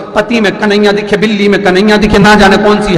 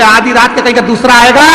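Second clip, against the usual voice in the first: irritated husband; 6 semitones higher, 3 dB louder than usual. Acted. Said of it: "पति बोले".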